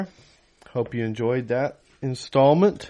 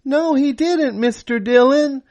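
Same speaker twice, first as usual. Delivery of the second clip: put-on much higher, louder voice of a teen boy; teenage voice; 6 dB louder than usual. Teenage boy answering Dylan from the crowd. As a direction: answer up like an eager kid. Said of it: "A teenage voice said".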